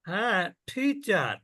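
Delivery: টিচার said slowly with a puff of air on the টি; breathy T. Shown as a demonstration. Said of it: "যখনই আপনি বলবেন টিচার".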